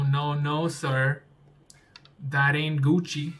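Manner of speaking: ordinary talk, nothing marked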